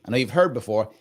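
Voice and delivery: usual speaking voice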